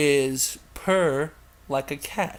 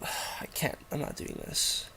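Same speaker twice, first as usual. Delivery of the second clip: defeated mumble; 11 dB quieter than usual. Real, not acted. Giving up after a sigh.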